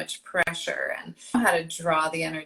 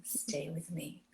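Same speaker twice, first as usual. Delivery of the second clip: slow breathy whisper, much softer; sexy whisper; 3 semitones lower, 14 dB quieter, slower than usual. Shown as a demonstration. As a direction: whisper it to pull him close. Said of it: "in that sweet, sexy voice".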